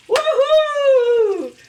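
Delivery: a high, loud woohoo; joyful cheer. Real, not acted. Cheering her own dish to viewers, a high loud whoop. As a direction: whoop for joy. Cheering the meal she made.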